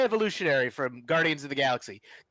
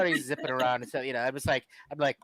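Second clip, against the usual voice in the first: ordinary delivery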